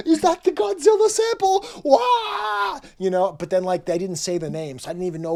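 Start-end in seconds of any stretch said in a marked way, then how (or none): 0.1-2.8 squeaky, much higher, loud yelp of surprise; cartoonish amazed squeal ending in a yell; amazed outburst. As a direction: shriek out the discovery. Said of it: "be just like".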